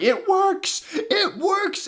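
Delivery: high gleeful mad villain yell; cackling villain. Retold or imitated. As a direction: crow it like a gloating evil wizard. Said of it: "cackling and going".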